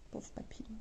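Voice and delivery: soft unvoiced whisper of mock pity. Real, not acted. Sewist whispering sadly over a cut butterfly, hushed. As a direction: breathe it like a little eulogy for the fabric.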